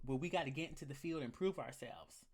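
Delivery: ordinary delivery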